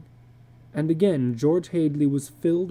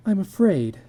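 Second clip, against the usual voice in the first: afraid; 5 semitones higher than usual. Acted. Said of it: "cried steadily".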